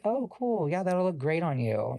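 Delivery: high, bright fake customer-service voice; fake cheerful. Stylist fakes cheer for the homophobic client's son, in a high, bright customer-service voice. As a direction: put on a sweet salon smile. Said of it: "I'm like".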